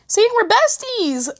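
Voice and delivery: high, slow sing-song teasing. Acted. Vlogger teasing viewers in a high sing-song. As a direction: stretch it out high and playful.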